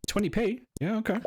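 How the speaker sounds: a mock-amazed squeak at the price; surprised. Real, not acted. Gamer reacting to a tiny scrap offer, incredulous and high.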